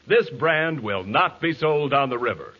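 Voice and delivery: normal talking voice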